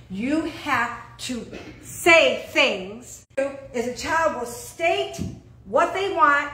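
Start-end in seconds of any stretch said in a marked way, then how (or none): 0.1-3.3 very slow, each word drawn out; said slower. Shown as a demonstration. Preacher repeating her point to the church, very slowly. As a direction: spell it out slowly for them. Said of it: "let me say it slower".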